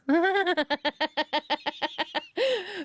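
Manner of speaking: long high burst of laughter; long rattling laugh; helpless laughter. Real, not acted. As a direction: crack up completely. Cracks up at the garbled message.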